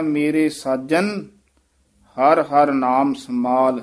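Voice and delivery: ਸਾਜਨ said tightly and slowly. Shown as a demonstration. Teacher models the tight ਸਾਜਨ, slow. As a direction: press the j in ਸਾਜਨ firmly, slow line. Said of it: "ਥੋੜਾ ਜਿਹਾ ਕਸ ਕੇ ਹੀ ਉਚਾਰਨ ਕਰੀਦਾ ਹੈ".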